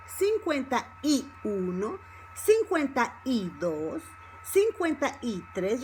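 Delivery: "cincuenta y uno" word by word, "y" a separate "ee"; slow, separated numbers. Shown as a demonstration. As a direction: teach it slowly, stressing "y" after "cincuenta". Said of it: "exaggerate the E".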